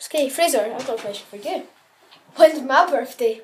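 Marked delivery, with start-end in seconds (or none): none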